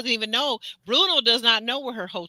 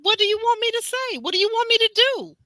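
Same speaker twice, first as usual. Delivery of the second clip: much higher, louder, fed-up; mock exasperated man; 12 semitones higher, 5 dB louder than usual. Host voicing Bernardo fed up on the phone, high and loud. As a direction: throw up your hands. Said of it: "Bernardo's like".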